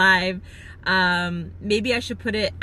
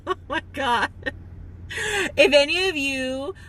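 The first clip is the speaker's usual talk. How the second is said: holding back tears; 3 semitones higher than usual. Real, not acted. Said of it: "I'm gonna start crying".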